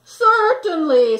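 loud, slow, drawn-out beaver; a friendly beaver's "certainly". A narrator's reading. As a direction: stretch it out kindly. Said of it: "said the beaver".

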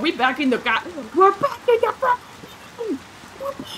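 very high, squeaky outburst; squeaky, strained high voice; high squeal. Real, not acted. Game streamer yelling in high panic squeal.

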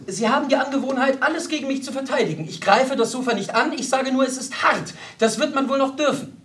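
high, loud, insistent general's wife; indignant rebuke. A narrator's reading. General's wife rebukes her companion.

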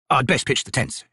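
ordinary delivery

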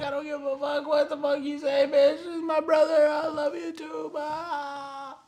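hammed-up crying brother; mock grief shout. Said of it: "Bob's like".

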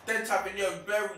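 loud, high urging at the players; urging shout. Real, not acted. Gamer yelling at his attackers to shoot, loud and high. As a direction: yell them forward, willing the attack on.

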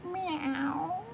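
high soft mewing meow; cat meow. Acted. Preston sneaking like a cat, soft meow.

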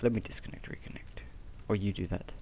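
muttered whisper. Real, not acted. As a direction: whisper it to yourself. Whispered aside.

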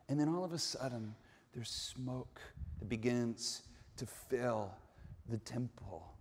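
low, hushed storytelling; hushed suspense. Acted. Builds suspense before smoke appears.

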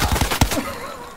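breathless, squeaky laughter; high wheezy laugh. Real, not acted. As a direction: laugh till you wheeze. Laughing at own run.

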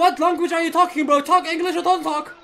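high, loud, heated demand; angry outburst. Real, not acted. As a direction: cut in angrily, pitched high and loud. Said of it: "You're too angry".

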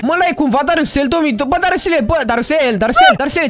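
low, dry voiceover telling viewers what happened; deep commentator voice. Acted. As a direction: drop into a deep narrator voice and tattle on Darusel. Deep voice calling Darusel.

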